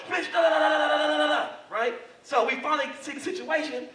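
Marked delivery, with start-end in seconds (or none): 0.1-1.5 raised-pitch angry nonsense syllables; mock cussing out. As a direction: imitate a tirade with da-da filler. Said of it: "the dude's cussing her out".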